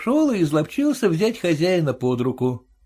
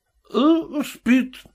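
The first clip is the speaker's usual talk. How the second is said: put-on drunk voice; 6 semitones higher, much slower than usual. A narrator's reading. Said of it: "пьяным голосом отвечал".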